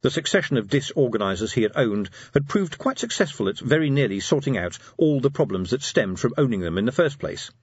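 ordinary talk

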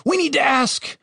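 high-pitched frantic shouting; shouted. A narrator's reading. Dave stops Cooper killing the prisoners, shouting. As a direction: cry out high, desperate to stop him. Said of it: "he shouted".

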